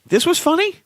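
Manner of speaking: incredulous. Retold or imitated. Incredulous quote of old comedy.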